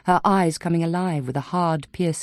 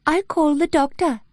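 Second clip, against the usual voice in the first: much higher, uncanny copy of the other woman's voice, a hint of Welsh; eerie imitation of Bryony; 11 semitones higher than usual. A narrator's reading. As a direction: mimic her exactly, but wrong and eerie. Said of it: "a perfect imitation of Bryony's, with the slight hint of a Welsh accent".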